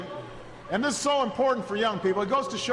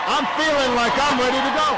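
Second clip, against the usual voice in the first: fired up; 5 semitones higher, 8 dB louder than usual. Real, not acted. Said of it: "I'm feeling kinda fired up".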